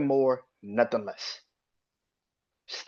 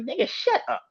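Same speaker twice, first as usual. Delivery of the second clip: shoots up high in disbelief, then cuts them off; exasperated high retort. Real, not acted. To online fans who keep complaining, a high exasperated shut up. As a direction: snap it back high and fed up.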